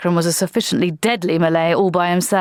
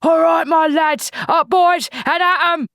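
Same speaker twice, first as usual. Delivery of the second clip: rally the lads as you charge; battle cry; 11 semitones higher, slower than usual. Acted. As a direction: sergeant leading the charge, shouting. Jackram charging out of grass.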